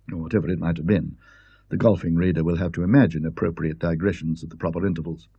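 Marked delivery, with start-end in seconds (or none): none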